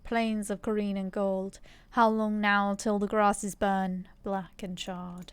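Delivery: slow, grave recital of verse; measured bard-like recital; solemn poem reading. Acted. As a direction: let each line sink in slowly. Reads game text slowly aloud.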